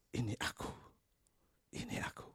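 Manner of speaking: soft unvoiced whisper of Jesus. Preacher whispers Jesus' words to the congregation. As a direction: breathe it like a gentle presence.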